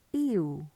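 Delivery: "ew" drawn out as a gliding vowel; combo vowel. Shown as a demonstration. Teacher offering the other vowel sound, gliding "ew". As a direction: sound out "ew" on its own. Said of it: "ew as in ew".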